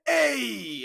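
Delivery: high, loud Italian-American shout; mock Italian-American accent. Shown as a demonstration. Host shows why Italian accents are fun, mock Italian-American accent. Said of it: "Italian Guinea wops".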